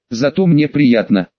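plain reading voice

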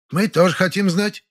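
high, insistent neighbour's voice; categorical. A narrator's reading. Neighbour insists on staying to hear, categorical. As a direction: declare it categorically, pitched well up. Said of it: "заявила Полина Харитоновна категорическим тоном".

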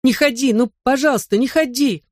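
high-pitched desperate pleading; crying-out child. A narrator's reading. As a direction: beg like a frantic little boy. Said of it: "закричал он".

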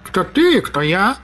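a purring tomcat voice; cat voice. Acted. Talking cat holds forth.

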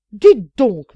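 loud furious cry; angry outburst. Acted. Maid losing her temper at the nun, loud furious cry. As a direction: explode at her in rage. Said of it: "Alors la colère me prit".

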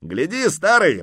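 high, loud, cheerful cry; merry exclamation. A narrator's reading. Horseman showing off to the old man, exclaiming merrily. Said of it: "воскликнул он весело".

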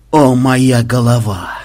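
slow, drawn-out moan; aching, long-drawn lament; pained groan. Acted. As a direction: groan over a splitting head. Player just hit on head.

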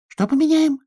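very high-pitched amazed question; astonished. A narrator's reading. Hedgehog gaping at the bear cub's plan, astonished squeak. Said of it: "ежик открыл рот".

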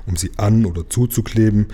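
ordinary delivery, nothing marked

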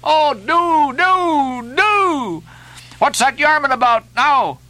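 high, pleading excitement in bursts; hopping-up-and-down eagerness, pitch leaping; excited cry. A narrator's reading. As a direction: beg your lads frantically to pull. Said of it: "cried Flash, dancing up and down".

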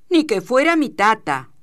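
high, loud scoffing outburst; insolent retort. A narrator's reading. Shearer mocking the man who scolded him, insolent. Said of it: "soltó el chino".

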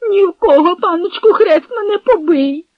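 high, loud squealing denial; whimpering plea. A narrator's reading. Captive swears innocence as his shoulder is squeezed, whimpering. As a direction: whine your oath in a high voice. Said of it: "заскавулів Копил".

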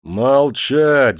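loud bellowed order; thundering command. A narrator's reading. Master silencing the manager, loud thundering order. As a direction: roar him silent. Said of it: "загремел Никита".

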